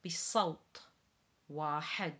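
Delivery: Arabic emphatic T. Shown as a demonstration. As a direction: give بصوت a strong emphatic T. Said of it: "The T in salt".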